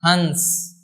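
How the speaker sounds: हंस with a long nasal "n" before the s; drawn-out nasal. Shown as a demonstration. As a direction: stretch the nasal in हंस: "hanns". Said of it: "इसका उच्चारण करेंगे हंस".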